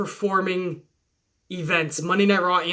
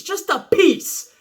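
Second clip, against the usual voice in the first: much higher, slow, drawn-out wrestler growl; Randy Savage; 9 semitones higher, slower than usual. Retold or imitated. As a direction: stretch it out like a hyped wrestling promo. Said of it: "as Randy Savage would say".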